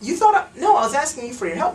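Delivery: high-pitched mock disbelief; feigned surprise. Feigns surprise at being rejected.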